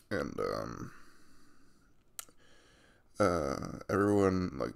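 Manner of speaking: ordinary delivery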